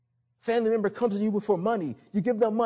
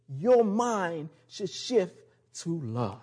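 slow emphasis; 3 semitones lower, much slower than usual. Acted.

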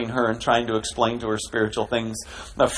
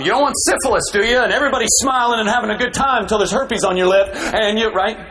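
higher mock preacher scare voice; mocking fear-monger; 9 semitones higher, 3 dB louder than usual. Pastor mimicking a fear-mongering minister, mocking. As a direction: imitate the scare-tactics minister, hammed up. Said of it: "It was fear-mongering".

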